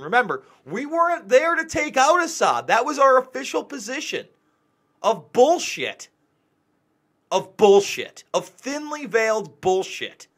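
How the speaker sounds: heated, venting genuine frustration; openly upset rant, voice pushed up. Real, not acted. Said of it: "I'm sorry I'm getting upset".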